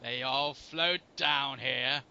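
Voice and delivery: low, very slow creepy voice; creepy quote. Acted. Quoting a creepy line to the co-host, low and slow. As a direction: draw it out low and eerie.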